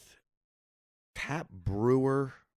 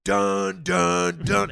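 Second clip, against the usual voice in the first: loud, high booming "dun"s; mimicked blaring intro music; 8 semitones higher, 10 dB louder, much slower than usual. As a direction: imitate a dramatic musical sting. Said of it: "this music comes blaring out".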